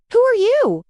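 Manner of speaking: spring the question on them; hammed-up magic stone asking; riddle question. A narrator's reading. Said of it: "This one glowed a bright blue".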